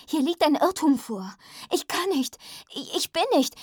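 high, loud, agitated objection; flustered protest, faltering. A narrator's reading. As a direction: protest desperately, stumbling to a halt. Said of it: "Ich kam ins Stocken".

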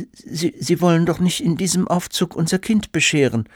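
high, stammered disbelief; aghast mother. A narrator's reading. Mother to student Santa about his outfit, aghast. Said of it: "fragte die Mutter entgeistert".

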